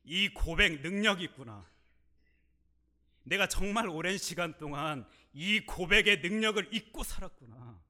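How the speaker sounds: preacher's ringing realisation; impassioned preaching. Real, not acted. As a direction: proclaim it with fervour. Preaching climax.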